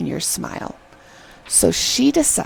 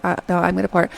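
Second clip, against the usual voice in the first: understated, level-pitched retort; guy's reply; much faster than usual. Retold or imitated. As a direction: imitate a guy holding his ground. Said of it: "and he's like".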